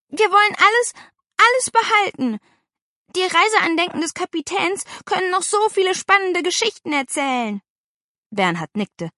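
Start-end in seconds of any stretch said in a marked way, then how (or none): 0.1-7.6 put-on high, loud childish insistence; vehement protest. A narrator's reading. Girl defending the captain's souvenirs, vehement. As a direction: plead hard for every last thing.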